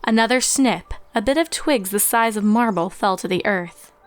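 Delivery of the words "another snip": plain reading voice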